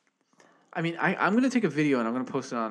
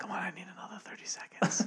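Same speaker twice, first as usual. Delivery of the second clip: laughing whisper; 6 semitones higher, 8 dB quieter than usual. Pretend. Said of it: "Keep it going".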